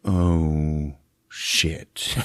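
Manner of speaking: low, breathy hushed curse; a muttered realization under the breath; mock dismay. Movie character quietly realizing trouble, hushed curse. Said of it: "And he's like".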